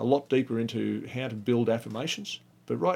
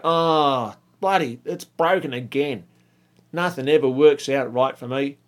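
exasperated moan, louder, like a broken gadget again; frustrated griping; 2 semitones higher, 5 dB louder than usual. Shown as a demonstration. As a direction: complain as if nothing ever works for you. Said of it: "Hear the difference?".

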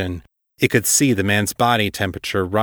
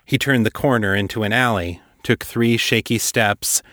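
ordinary delivery, nothing marked

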